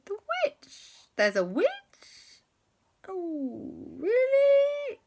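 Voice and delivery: very high squeaking surprise; amazed disbelief. Acted. The children hearing of a witch, high amazed squeak. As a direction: gasp it out in disbelief.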